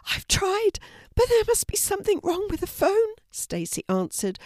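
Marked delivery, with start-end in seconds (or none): 0.1-3.1 much higher, strained and urgent; frantic pregnant woman pleading for help; panicked woman in labour. A narrator's reading. Stacy in labour.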